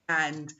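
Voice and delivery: "and" stretched with an open a; over-pronounce every sound of "and"; full-form "and". Shown as a demonstration. Teacher mocking the slow full form, open vowel. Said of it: "We haven't got time to say".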